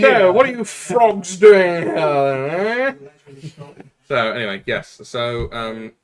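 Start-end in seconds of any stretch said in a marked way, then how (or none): 0.0-3.4 put-on loud, slow, high sneering British accent; mock British officer. British soldier sneering at French crew, hammed-up accent. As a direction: drawl it out like a pompous officer.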